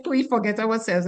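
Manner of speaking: normal talking voice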